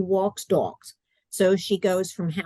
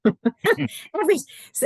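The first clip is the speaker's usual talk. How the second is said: laughing; 9 semitones higher, slower than usual. Real, not acted. Laughs at own remark.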